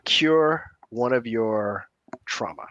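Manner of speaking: ordinary delivery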